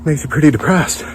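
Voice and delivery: normal talking voice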